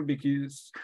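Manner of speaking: usual speaking voice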